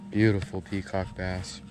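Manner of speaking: ordinary talk, nothing marked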